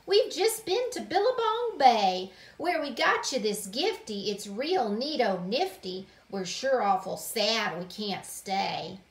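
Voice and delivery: loud, folksy aunt voice; Aunt Olga. Acted. Aunt bringing a gift from a trip, loud and folksy. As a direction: holler the news, starting high and easing down.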